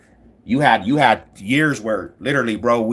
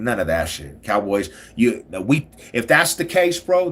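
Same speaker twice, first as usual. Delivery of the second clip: normal talking voice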